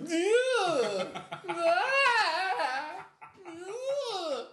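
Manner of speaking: very high piercing mock screams; imitated night terror screams. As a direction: mimic her screaming from the bedroom. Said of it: "Just like".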